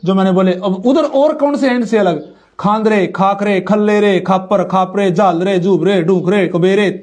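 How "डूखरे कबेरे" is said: ordinary delivery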